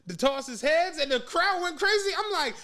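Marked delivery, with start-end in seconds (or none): none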